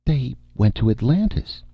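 high, vacant announcement; faintly triumphant. A narrator's reading. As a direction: announce it with mild pride. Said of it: "an air of faint triumph".

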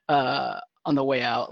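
normal talking voice

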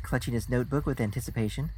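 ordinary delivery

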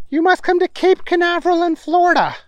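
high, bossy scientist voice; high professor voice. Acted. Stinkwell voiced.

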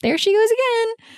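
high-pitched mock exclamation; playful, high-pitched self-mockery; poking fun at herself. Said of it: "I like to make fun of myself".

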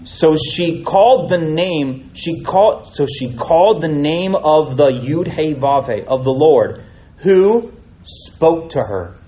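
slow, weighty reading of the angel's words; scripture reading. Acted. Preacher reading the angel's words to Hagar, slow and weighty. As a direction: let each phrase land, unhurried. Reads Genesis passage aloud.

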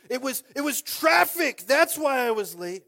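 mock excuse. Mocking a late excuse.

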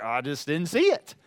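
mock-sheepish, low and laughing; sheepish excuse. Preacher retells excusing himself to his wife, mock sheepish. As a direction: offer a small, low excuse with a chuckle.

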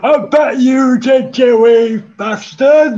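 put-on loud old Geordie dad, shouting; Geordie old father. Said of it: "your father, Geordie Geriatric".

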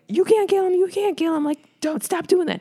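high-pitched mock pleading; imitating a desperate plea, way up high; mock begging. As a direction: beg in a squeaky, put-on high voice. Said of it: "begging him".

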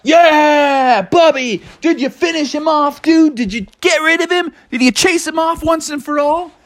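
high, eager hero-welcome voices; excited friends. Acted. Animals greet returning Bubby, high and excited. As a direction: pepper him with eager questions.

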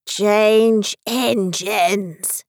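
very slow, hoarse character voice. A narrator's reading. Unseen horse calling out in the carriage, slow and hoarse. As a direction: drag the words out hoarsely. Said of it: "but a hoarse voice spoke next".

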